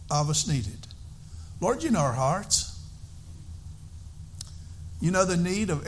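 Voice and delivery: earnest pastor's prayer. Acted. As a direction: pray aloud to the congregation. Opening prayer.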